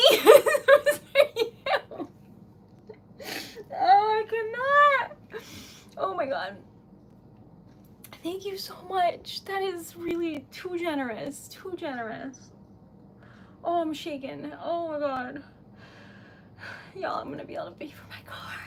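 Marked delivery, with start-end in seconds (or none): 0.0-5.2 laughing through happy tears; choked up and laughing; tearful. Real, not acted. 16.9-18.5 fast, hushed whisper. Real, not acted. Rushed whisper.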